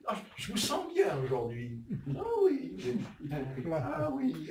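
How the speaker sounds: mock-sweet greeting with swooping pitch; butter them up with cooing compliments; sugary flattery. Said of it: "quand on veut obtenir quelque chose".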